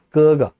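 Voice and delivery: 그거 with first syllable drawn out, second short; correct Chinese gege. Shown as a demonstration. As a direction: say 그거 from the tongue root, long then light. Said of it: "정확하게 발음할 수 있도록".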